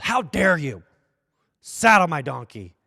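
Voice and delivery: mild mock indignation, slowing on the command; a touch of offended pride, then order it; indignant defiance. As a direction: Mephibosheth brushing off pity, mildly indignant. Mephibosheth defies the pity.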